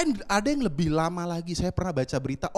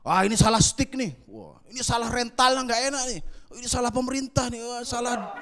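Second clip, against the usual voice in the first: higher, whining complaints piling up, ending in a laugh; whiny loser blaming all; 6 semitones higher, 5 dB louder than usual. Retold or imitated. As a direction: blame everything like a sore loser. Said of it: "jadi kalau kalah misalnya". (mengeluh merengek menyalahkan semuanya, lebih tinggi)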